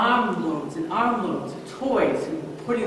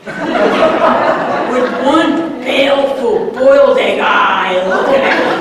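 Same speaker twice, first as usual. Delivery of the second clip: louder, slow and laughing through the words; 5 semitones higher, 12 dB louder, slower than usual. Real, not acted. Telling the audience about the fish meatloaf, laughing loudly. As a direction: crack up at the egg eye. The boiled egg eye.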